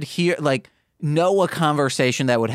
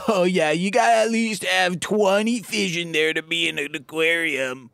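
higher, snooty know-it-all voice; pretentious; 5 semitones higher than usual. Retold or imitated. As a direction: lecture like a fish snob. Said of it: "some pretentious thing like".

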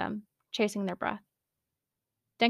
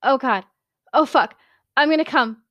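high, loud climax cries; high-pitched yell; 5 semitones higher, 9 dB louder than usual. Acted. Denki climaxing on the phone, high loud cries. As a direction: cry out on the edge. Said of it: "let out a high-pitched yell".

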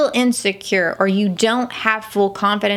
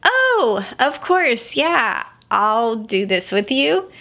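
forced smile; slower than usual. Retold or imitated. Mother hiding her fear from her son, putting on a bright smile. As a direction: smile it on though you're terrified. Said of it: "I put on a smile".

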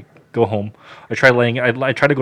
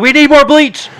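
high, loud frantic yell; panicked high-pitched shout; urgent yelling; 14 semitones higher, 9 dB louder than usual. Acted. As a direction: holler the demand at dad. Yells demand at dad again.

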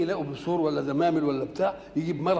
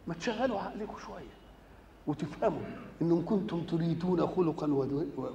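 ordinary talk

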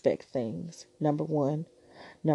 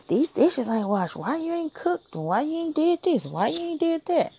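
high-pitched mock nagging; hammed-up nag about dishes and cooking; nagging spouse; 10 semitones higher than usual. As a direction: whine each why much higher, like a nagging partner. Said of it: "Or nagging to you".

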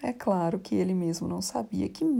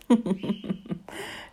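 hysterical laugh. Real, not acted. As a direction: burst of helpless laughter. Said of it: "Desculpe essa crise de riso".

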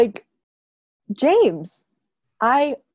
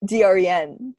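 ordinary delivery

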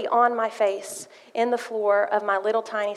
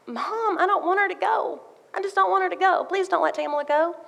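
put-on pleading little girl; 8 semitones higher than usual. Child begging mom, sister leaving.

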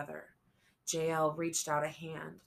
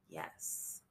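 breathy whisper; 12 semitones lower, 11 dB quieter than usual. A narrator's reading. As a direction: barely breathe it. Silent sign language.

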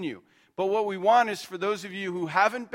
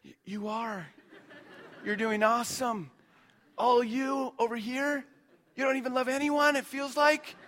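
mock reassuring pastor; 7 semitones higher than usual. Pastor's mock reply to the teens.

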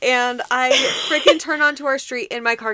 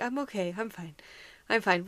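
quiet denial; 4 semitones lower, 9 dB quieter than usual. Retold or imitated. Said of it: "I'm like".

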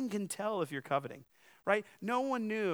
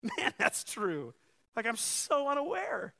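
amused realization; 6 semitones higher than usual. Retold or imitated. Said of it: "I'm sitting there like".

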